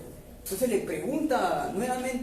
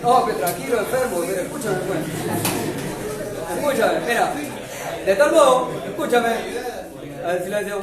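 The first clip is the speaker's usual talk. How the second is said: loud, raised voice over a noisy room; 3 semitones higher, 7 dB louder than usual. Real, not acted. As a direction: cut through the chatter to regain attention. Calls class to order mid-story.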